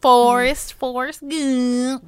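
slow, savoured repeat of the name; lingering, drawn-out Forrest Gump; drawn-out title. Acted. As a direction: stretch the name out, relishing it. Host savors the movie title.

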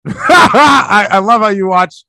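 high burst of laughter; laughing shout. Real, not acted.

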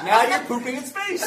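loud, high, uproarious delivery; real hilarity, voice pushed up high; laughing punchline. Real, not acted. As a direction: shout the punchline over the laughter. Tops the joke amid laughter.

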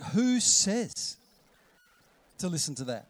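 indignant questioning of authority; defiant challenge. Acted. Challenging the inner voices.

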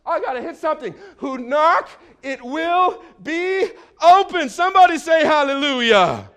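high, loud preacher's cry; full-force preaching. Real, not acted. Preacher rousing the congregation to say hallelujah, full-force preaching.

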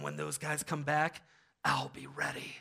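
low, soft, steely resolve; hushed movie-hero vow; determined Kevin. Retold or imitated. Kevin at the window vowing to face the burglars, low and quiet. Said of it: "he's looking out the window".

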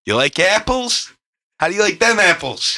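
Good Will Hunting Boston impression; mock Boston accent, pitched high. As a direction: do the apples line in a hammed-up Boston accent. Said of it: "a Matt Damon from Good Will Hunting".

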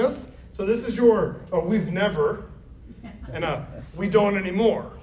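normal talking voice

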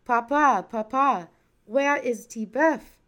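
high, loud eager calls to papa; children calling out. A narrator's reading. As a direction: call out to papa across the yard. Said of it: "his children called out".

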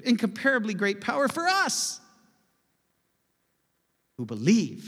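us lifted high, then who believe said firmly; stress on us. Shown as a demonstration. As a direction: point it at us. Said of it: "I'll emphasize a different word each time".

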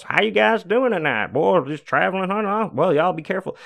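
high, sunny, folksy store clerk voice; overly nice southern cashier chatting; super friendly clerk. Retold or imitated. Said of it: "just super nice, like, so personable to us".

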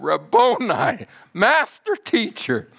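high, drawn-out cry of recognition; joyful recognition. Acted. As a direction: exclaim it as Mary finding her teacher. Mary recognises the risen Jesus.

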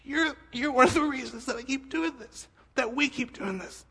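choked up, fighting back tears; crying. Real, not acted. Pastor thanking Jeremy, voice breaking with tears. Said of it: "I'm gonna cry again".

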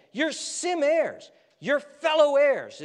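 high, loud proclamation; fervent preaching. Acted. Preacher proclaiming to Gentile listeners that they belong, fervent and loud. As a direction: preach it out with rising fervor.

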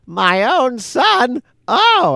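high squeaky pappy surprise; pappy surprised. Retold or imitated. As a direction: play the old man suddenly realizing. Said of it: "he goes".

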